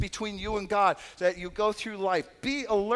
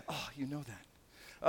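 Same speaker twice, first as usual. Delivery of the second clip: deflated muttered aside; 6 semitones lower, 13 dB quieter, slower than usual. Retold or imitated. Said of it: "And they say".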